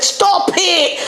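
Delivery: put-on high-pitched stab at a Caribbean accent; attempted Caribbean accent. Telling the mum-at-my-yard story in an attempted Caribbean accent. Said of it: "try and do a Caribbean accent".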